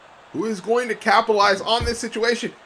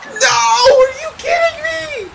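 high, loud disbelieving cry; disbelieving shout; 7 dB louder than usual. Real, not acted. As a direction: yell it, unable to believe it. Another near-fall kickout.

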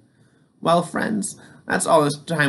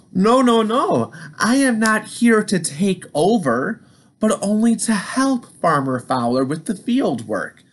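Johnny protesting; 8 semitones higher than usual. Acted. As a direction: insist kindly it's not a takeover. Tractor Johnny protests loudly.

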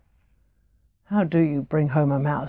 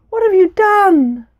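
much higher and louder, anguished outcry; horrified cry; 12 semitones higher, 7 dB louder than usual. Acted. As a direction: scream it in horror at the brother. Mika sees mouse kicked away.

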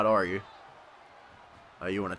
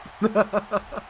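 higher-pitched, rolling chuckle; amused laugh; 6 semitones higher than usual. Real, not acted. Player laughing after threatening opponent with a mop, amused. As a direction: laugh at your own taunt.

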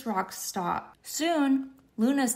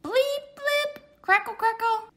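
high crackly radio bleeps; 11 semitones higher than usual. Acted. Radio message arrives for Luna, high bleeping crackle. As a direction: squeak the beeps like a space radio.

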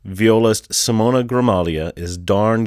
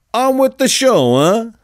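high, loud goofy voice; a hammy showman flourish; silly voice; 13 semitones higher, 5 dB louder than usual. Acted. Playful sign-off with a twist.

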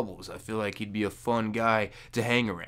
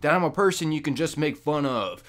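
usual speaking voice

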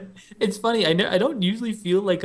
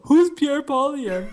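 imitating Bo; 8 semitones higher, 3 dB louder, slower than usual. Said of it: "pretend to be Bo".

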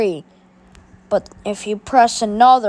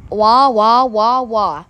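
fake crying; 2 semitones lower, slower than usual. Caillou crying after mockery.

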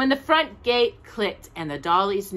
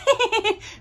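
Grandma's laugh; 3 dB louder than usual. A narrator's reading. Narrator voicing Grandma's laugh, a high chuckle. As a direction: let Grandma laugh gently. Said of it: "Grandma laughed".

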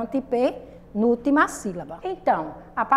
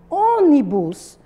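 "Ônibus" with a long, loud ÔN; stress on first syllable; 6 dB louder, much slower than usual. Shown as a demonstration. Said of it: "a sílaba mais forte é o on".

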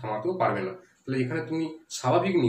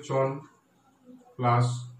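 "চন", "দন", "ন", "গড়" slowly separated with pauses; syllable by syllable; much slower than usual. Shown as a demonstration. Teacher breaking Chandannagar the way it is pronounced, syllable by syllable. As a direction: dictate "Chandan plus নগর" in slow pieces. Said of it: "সেইভাবে ভাঙতে হবে".

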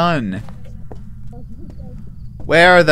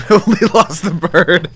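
laughing; 5 semitones higher, 5 dB louder than usual. Real, not acted. Laughing echo.

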